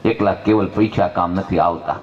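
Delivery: ordinary talk, nothing marked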